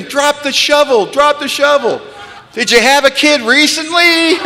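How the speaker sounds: inner screaming. Retold or imitated. The brain screaming at him to stop digging, high and loud in panic. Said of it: "and my brain screaming".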